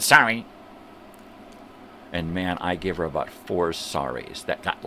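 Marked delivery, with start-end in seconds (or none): 0.0-0.5 louder, clipped mock sorry; sharp loud sorry. The woman apologizing because her kids watch, loud. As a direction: toss off a loud quick apology. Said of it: "so of course she said".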